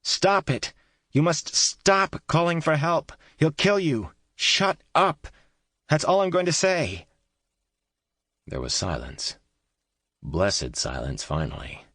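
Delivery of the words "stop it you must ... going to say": high, loud frantic shout; desperate shout. A narrator's reading. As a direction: yell with all your strength to silence her. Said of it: "shouted as loud as she could".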